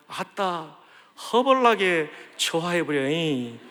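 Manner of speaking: playful Jeolla dialect, slow. Retold or imitated. Preacher joking how Jeolla people would say it, mild Jeolla accent. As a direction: lightly put on a Jeolla accent. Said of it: "전라도 말로 하면".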